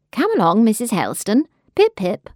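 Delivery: brisk jolly-hockey-sticks. Acted. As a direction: chivvy her along briskly. Nurse hurries mother brightly.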